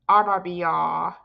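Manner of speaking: normal talking voice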